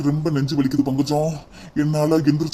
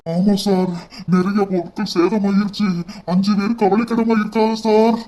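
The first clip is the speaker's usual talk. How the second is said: normal talking voice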